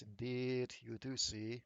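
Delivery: ordinary talk, nothing marked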